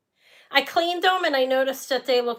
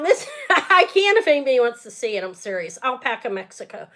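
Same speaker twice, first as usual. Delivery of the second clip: ordinary talk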